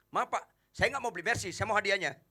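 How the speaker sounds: high, pushy insistence; stubborn customer. Acted. Customer tells the salesman he only wants the prize, pushy and high. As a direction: demand the prize and refuse the car. Customer refuses to buy the car.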